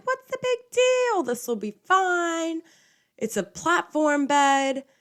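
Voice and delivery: mock her clueless past self; carefree high voice. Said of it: "and I'm like".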